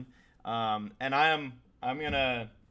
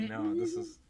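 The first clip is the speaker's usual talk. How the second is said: quoted self. Retold or imitated. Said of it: "but I'm like".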